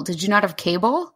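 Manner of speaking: usual speaking voice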